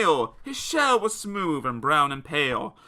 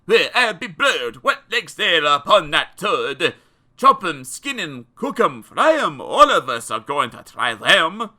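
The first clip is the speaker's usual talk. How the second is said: loud, hungry mob shouting; louder, rowdy mob cry; screaming French mob; 2 semitones higher, 8 dB louder than usual. Acted. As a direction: yell it like a greedy crowd. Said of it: "They screamed in French".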